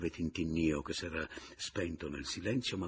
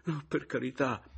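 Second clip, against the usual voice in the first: high, urgent entreaty; imploring; 8 semitones higher than usual. A narrator's reading. Rival begging them not to fetch his wife, imploring. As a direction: beg them desperately not to. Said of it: "scongiurò Pomino".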